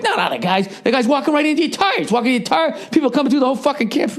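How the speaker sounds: high, indignant mimicry, speeding up; worked-up protest. Friend explaining why he blew up, mock worked-up high protest. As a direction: imitate a friend excitedly defending his outburst. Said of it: "And I just started laughing".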